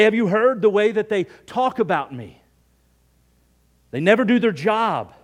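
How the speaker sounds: mock-aggrieved griping; acted complaining. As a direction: air a grievance, hammed up. Acts out complaint about others.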